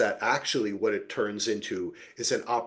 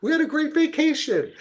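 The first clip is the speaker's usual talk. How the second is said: much higher, airy and cheerful; breezy mock cheer. Host mocking the women on the boat, breezy high cheer. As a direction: sing it out like nothing happened. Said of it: "they're like".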